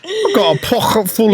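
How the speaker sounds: put-on high-pitched comic British accent. Continuing the mock interview answer, British accent. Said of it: "be in British accent the whole time".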